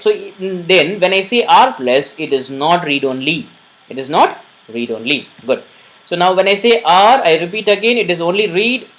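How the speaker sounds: ordinary talk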